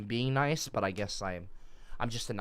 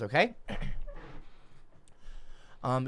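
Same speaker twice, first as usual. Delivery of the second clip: ordinary talk, nothing marked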